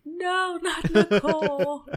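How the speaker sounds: high, slow, teary wail, half laughing; mock sobbing plea. Retelling her tears at the show, in a high mock sob. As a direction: ham up a tearful protest. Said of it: "my eyes were, like, filled with tears".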